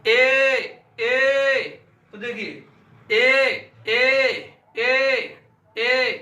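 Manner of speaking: ए said loud and long from the throat; demonstrating e sound. Shown as a demonstration. Teacher sounding out ए for the class. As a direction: sound out ए clearly. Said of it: "समझते हैं इनका उच्चारण".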